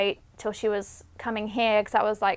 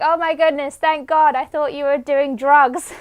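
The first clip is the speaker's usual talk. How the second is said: relieved dad, retold; 4 semitones higher, 6 dB louder than usual. Retold father's relieved reaction.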